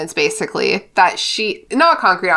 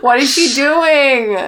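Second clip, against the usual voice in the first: slow, higher, drawn-out question; exaggerated bewilderment; 4 semitones higher, slower than usual. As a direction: draw it out in mock exasperation. Acts out baffled Ron.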